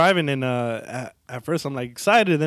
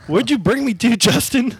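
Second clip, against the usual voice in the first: higher-pitched, alarmed inner voice; mock panicked protest; 6 semitones higher, 4 dB louder than usual. Thinking in his head at Justin, mock panic. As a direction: raise it in mock alarm at your friend.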